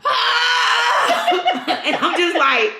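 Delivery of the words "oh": mock the singalong with a high pop-star whoop. Mocking her friend singing along, high [name]-style shriek. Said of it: "screaming like [name]".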